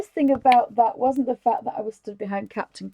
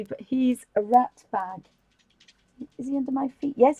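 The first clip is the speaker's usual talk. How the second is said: ordinary talk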